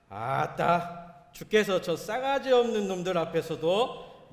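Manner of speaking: 과장된 전라도 사투리, 크고 높게; Jeolla dialect. Shown as a demonstration. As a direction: belt the psalm in broad Jeolla dialect for laughs. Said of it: "전라도 말로 말씀을 드릴 텐데".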